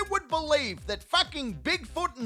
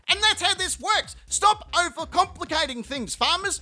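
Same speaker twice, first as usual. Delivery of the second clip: mock rant; 6 semitones higher than usual. Ranting at farmers to shut up.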